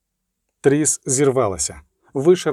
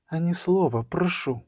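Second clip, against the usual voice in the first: soft, higher-pitched plea for silence; hushed request; 4 semitones higher, 5 dB quieter than usual. A narrator's reading. Triss silences Eskel while Ciri drinks, hushed. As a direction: hush him softly.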